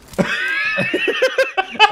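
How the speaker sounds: very high squeal of laughter; high pitched giggling squeal. Real, not acted. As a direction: shriek with laughter at your own jump. Laughs after startled reaction.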